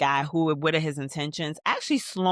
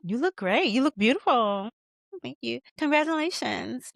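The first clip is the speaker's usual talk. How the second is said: high, lilting mock gush; gushing party compliments; 7 semitones higher than usual. Guests greeting each other at Diane's party, gushing. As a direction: swoop up on each polite compliment.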